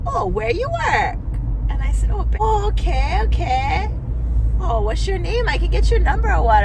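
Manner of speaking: imitating a forward stranger; flirty guy. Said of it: "and he's like".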